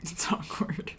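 whispered aside. Real, not acted. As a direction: drop to a whisper. Aside whispered during banter.

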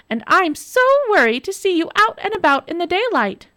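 high, sugary fake worry; mock concern. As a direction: pretend to worry sweetly.

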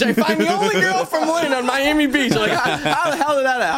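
very high-pitched, incredulous rant; comic exasperation. Retold or imitated. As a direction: wail it in mock disbelief, laughter creeping in. Exasperated punchline of bar story.

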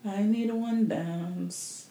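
slow, soft mock [name] croon; lightly voiced [name] impression, drawn out and hushed; a hint of [name] crooning the lyric. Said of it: "And I'm saying it in my [name] voice".

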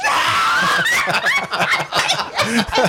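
high squealing laugh; shrieking laugh. Real, not acted. Laughing at own joke.

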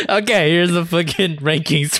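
laughing through the announcement; amused. Real, not acted. Host getting back to the rankings after banter, amused.

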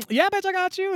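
playful high-pitched yes; eager agreement. Retold or imitated. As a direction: pipe up high to offer help. Paloma eagerly agrees to help.

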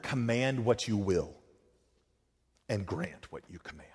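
Augustine's prayer, hushed and reverent; hushed prayer quote. Retold or imitated. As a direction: pray it quietly, almost to yourself.

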